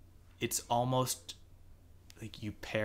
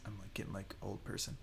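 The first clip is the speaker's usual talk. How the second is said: whispering; 3 semitones lower, 13 dB quieter than usual. Real, not acted. Joking aside about aging.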